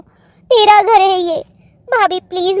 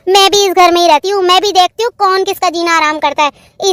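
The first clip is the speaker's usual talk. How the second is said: normal talking voice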